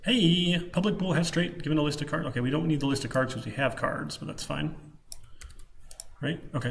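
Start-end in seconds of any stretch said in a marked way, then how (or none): none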